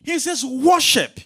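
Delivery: loud, high-pitched proclamation; full-force preaching. Real, not acted. Preacher declaring God's healing promise to congregation, full-force preaching. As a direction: drive the promise home at full volume.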